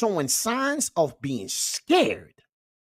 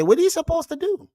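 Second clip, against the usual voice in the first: mock whiny woman; 13 semitones higher, 4 dB louder than usual. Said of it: "Even women said".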